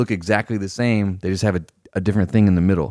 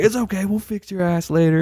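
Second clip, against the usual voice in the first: mock threatening officer; 12 semitones higher than usual. Superior threatens him.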